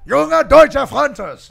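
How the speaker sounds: Junge Deutsche Fronters 大声喊出，声调高，拖得很慢; 照着念，把 Junge Deutsche Fronters 喊出来; shouted repeat. Shown as a demonstration. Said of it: "Say it".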